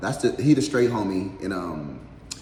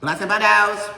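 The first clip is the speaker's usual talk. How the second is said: squeaky catchphrase; 14 semitones higher, 9 dB louder than usual. Pretend. High put-on catchphrase voice.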